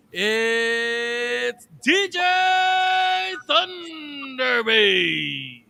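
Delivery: announcer shout. Acted. Grand self-introduction.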